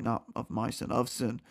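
plain reading voice

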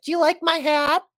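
high mocking singsong of a clownish wrestler; lightly mocking imitation; mocking Becky. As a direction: parody her silly question in a high voice. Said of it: "she was a fucking clown".

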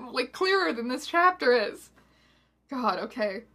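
ordinary delivery

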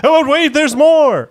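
slow, high and loud British pitchman; hammed-up British TV-ad host announcing a bonus; British infomercial announcer, drawn out and loud. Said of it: "like those British guys who do the infomercials".

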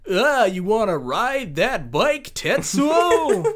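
high, slow mock hero voice; Cam Clark dub voice. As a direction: ham up a cocky dub line. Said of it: "hear Cam Clark being like".

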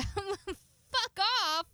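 mock offended, laughing; laughing retort. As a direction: snap it back through a laugh. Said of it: "And I was like".

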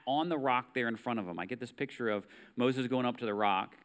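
ordinary talk, nothing marked

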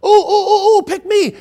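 put-on high, excited pleading like a child wanting picking; eager volunteer. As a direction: squeal repeated oohs, begging to be chosen. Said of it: "Isaiah with his hand raised in the air going".

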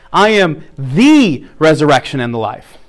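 slow down and stress the before resurrection. Shown as a demonstration. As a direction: press on the. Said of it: "just emphasize each one".

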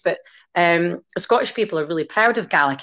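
usual speaking voice

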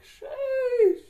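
loud, very high drawn-out squeal; high, whooping cry of delight; elated exclamation. Real, not acted. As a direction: shoot the pitch way up and yell it. Exclaims over a satisfying stretch.

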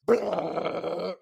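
imitating a dog's growl. Growling at a yappy Pomeranian, mock dog growl.

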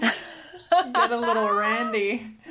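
put-on slow, drawn-out New York accent. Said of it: "I love your New York accent".

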